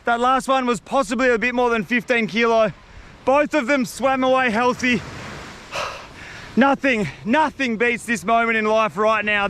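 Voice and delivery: ordinary talk, nothing marked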